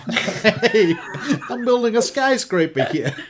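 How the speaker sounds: high, loud laughing New York developer; New York accent cracking into laughter. Pretend. Continues New York developer bit.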